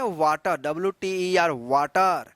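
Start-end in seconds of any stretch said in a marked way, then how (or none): 0.0-0.6 वाटर said "waa-tar", open aa; habitual "aa" misreading. Shown as a demonstration. Teacher showing how we have been reading it, with aa. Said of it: "अभी तक हम लोग पढ़ते आ रहे हैं". 1.5-2.3 वाटर said "waa-tar", lower pitch; drawn open aa in वाटर; habitual "aa" misreading. Shown as a demonstration. Teacher showing the old habitual reading, with aa. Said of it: "अभी तक हम लोग पढ़ते आ रहे हैं".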